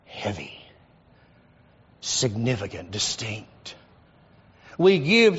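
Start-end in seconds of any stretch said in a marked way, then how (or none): 0.1-3.8 hushed emphasis. Real, not acted.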